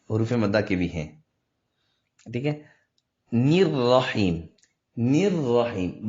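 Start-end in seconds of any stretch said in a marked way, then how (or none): 3.3-6.1 "نیر رحیم" drawn out very slowly with a held long ee; correct Quranic recitation. Shown as a demonstration. Teacher models the verse ending for students, correct slow recitation. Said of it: "نیر رحیم نہیں".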